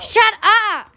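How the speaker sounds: exasperated outburst; annoyed shout. Real, not acted. Bickering, snaps back after echo.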